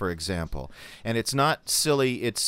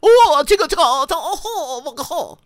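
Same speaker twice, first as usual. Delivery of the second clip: much higher, loud, slow mocking fake Chinese accent with a laugh; 10 dB louder, much slower than usual. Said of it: "I'm not talking about that".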